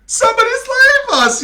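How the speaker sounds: very high, loud yell for help; terrified yell. Acted. Fleeing actor yelling for help, terrified. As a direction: cry out for rescue. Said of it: "yelled Francis".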